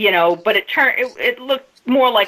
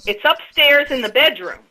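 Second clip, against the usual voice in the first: higher, half-raised mock yell; retold yell; 6 semitones higher than usual. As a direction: call it out across the table. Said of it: "she yells out".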